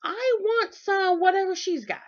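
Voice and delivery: high, bright mock eager voice; eager onlooker. Imitating an admiring stranger, high and eager. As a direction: gush like someone wanting what she has. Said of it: "they might actually say".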